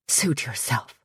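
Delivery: low, soft and haughty; a soft, disdainful sniff of a reply; sniffy dismissal. Acted. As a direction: brush her off with a sniff. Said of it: "sniffed Carmen".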